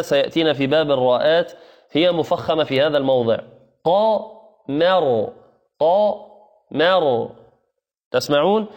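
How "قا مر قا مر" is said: قا said thick and مر light, very slowly; tajweed syllable demo; heavy then light syllables. Shown as a demonstration. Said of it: "نقول قا مفخم".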